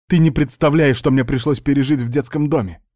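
agitated reproach. A narrator's reading. Niece to uncle about the orphanage, agitated. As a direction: burst out with the old hurt. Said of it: "успокоиться не получалось".

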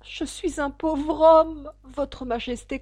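high, slow, drawn-out plea; pleading hatter. A narrator's reading. The hatter kneels before the king, pleading. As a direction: beg the monarch for mercy.

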